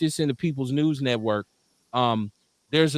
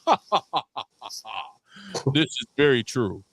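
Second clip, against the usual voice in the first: evil scientist laugh; 2 semitones lower than usual. Retold or imitated. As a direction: laugh like a hammy mad scientist. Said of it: "that whole evil scientist laugh like".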